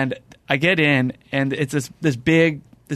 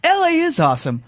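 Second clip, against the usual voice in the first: mock excitement; 13 semitones higher than usual. Caught up in the driver's joy, mock cheering for LA. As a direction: cheer for LA half-jokingly. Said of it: "So I'm just in the car and I'm like".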